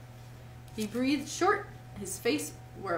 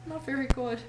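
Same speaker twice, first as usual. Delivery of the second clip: plain reading voice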